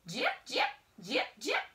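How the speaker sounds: playful monkey calls; monkey chatter. A narrator's reading. Monkey sound in story.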